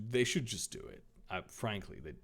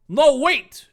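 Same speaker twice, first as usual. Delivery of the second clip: high, loud shout of protest; loud high call; 11 semitones higher, 10 dB louder than usual. Acted. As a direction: call out loudly to stop them. Dave calls out.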